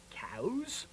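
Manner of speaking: hushed whisper before the moo. Shown as a demonstration. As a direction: breathe the word, no voice.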